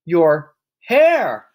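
high, loud shriek. A narrator's reading. Mother pointing at her husband's hair, shrieking. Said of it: "the mother shrieked".

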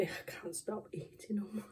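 fast whispered confession; whispering. Real, not acted. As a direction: whisper it guiltily. Whispered confession while eating.

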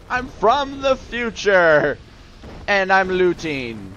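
high, slow, drawn-out declaration; hammed-up explaining; mock grand announcement. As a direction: proclaim it slowly to baffled soldiers. Acted reply to baffled soldier.

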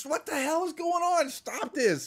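high, mock-furious shout; furious yell. Old marshal bursts in, high angry mock yell. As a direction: roar at them like an old marshal. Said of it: "the old marshal goes".

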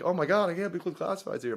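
imitating an excited fan's gush; starstruck fan freaking out. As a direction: gush like a fan who can't believe it. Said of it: "just freaking out".

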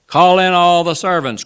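high, loud order to the household; hearty cry. A narrator's reading. Old Wardle summons servants for the toast, loud cry. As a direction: cry it out across the room. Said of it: "cried old Wardle".

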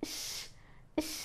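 "ఇష్" as one hushing sh sound; digraph sound demo. Shown as a demonstration. Teacher showing how SH is read, "ఇష్" as one hushed sound. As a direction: say "ఇష్" with a sustained sh. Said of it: "అని చదవాలి".

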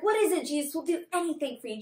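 lightly acted, keen and quick to please; a touch of over-eager follower; eager disciples. Teacher voices disciples answering Jesus, mildly eager.

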